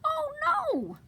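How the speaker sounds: high, swooping mock alarm; storybook dismay. Reader to child as both trucks stick, mock dismay. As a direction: cry out in pretend dismay.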